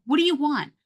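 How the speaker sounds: put-on exasperated parent snapping at a child; snapped question. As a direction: bark it out, fed up. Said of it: "you're like".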